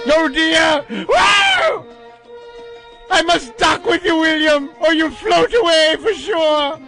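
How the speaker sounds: campy shrieking melodramatic ham; hammy stage actor. Pretend. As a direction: overact every line like a coward. Continuing Doctor Smith parody.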